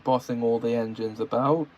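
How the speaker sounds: usual speaking voice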